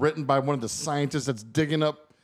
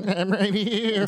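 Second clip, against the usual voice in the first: laughing echo; 8 semitones higher, much slower than usual. Pretend. Echoing the sheep voice, laughing.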